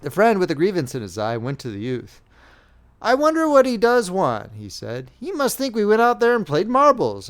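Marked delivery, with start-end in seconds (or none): 3.0-7.3 aggrieved. A narrator's reading. Aggrieved friend complains.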